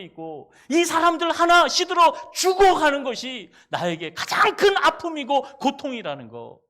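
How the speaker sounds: loud, high, grieved appeal; God pleading. Acted. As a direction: cry out over the lost souls of Nineveh. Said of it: "결국에는 이 얘기예요". (니느웨를 아끼는 하나님, 크게 호소하듯)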